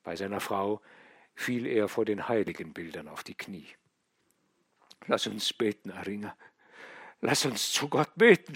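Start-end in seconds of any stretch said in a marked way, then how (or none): 5.1-8.6 moaning plea. A narrator's reading. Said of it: "stöhnte er".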